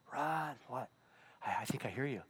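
hushed listening. Acted. Finally hearing God's voice.

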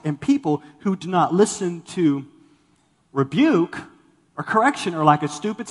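usual speaking voice

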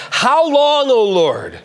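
high, loud cry of how long; lamenting psalm cry; pleading lament. Retold or imitated. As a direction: cry out to God in anguish. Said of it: "He starts like this".

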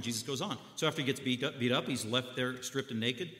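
ordinary delivery

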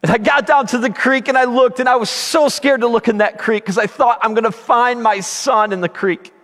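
high, urgent preacher's narration; impassioned storytelling. Real, not acted. Preacher telling of riding down to the creek, impassioned. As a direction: relive the panic as you tell it. Relives search at raised pitch.

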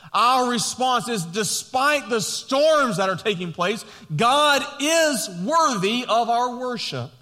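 rising into loud, high preaching. Acted. Pastor building to a declaration to the congregation, loud preaching.

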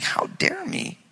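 whispered, high disbelief at "How dare me?"; incredulous whisper. Retold or imitated. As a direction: breathe it out in disbelief. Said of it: "I'm like".

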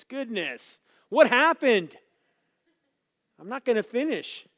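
high, loud exclamation of alarm; dismayed surprise. Real, not acted. Preacher finding eight minutes left, loudly dismayed. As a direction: cry out as if time has vanished.

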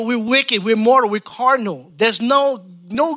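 ordinary delivery

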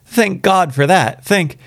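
very high, drawn-out thanks, cut short; relief broken off. A narrator's reading. Old woman clasping her hands, fervent relief breaking off suddenly. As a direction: pour out thanks, then stop abruptly. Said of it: "she broke off suddenly".

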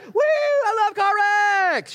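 very high, slow, loud whoop; mock cheering shout. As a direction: whoop like a thrilled fool. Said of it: "you jump out".

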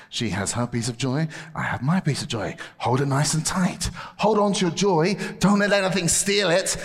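ordinary delivery

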